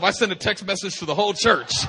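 ordinary talk

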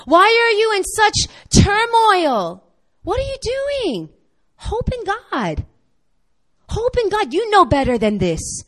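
high, loud self-rebuke, building to a shout; scolding himself. Retold or imitated. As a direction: shake yourself out of it, loudly. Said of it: "And he's like".

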